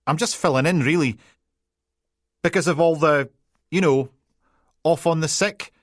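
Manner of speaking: high, loud, tumbling Peterhead apologies; flustered Peterhead PC. Acted. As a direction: backpedal fast in broad Peterhead. PC explaining awkwardly.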